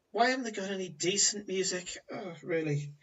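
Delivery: bored, sighing complaint; acted teenage boredom; bored young woman. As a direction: grumble through a sigh, unimpressed by everyone. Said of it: "she sat there completely bored".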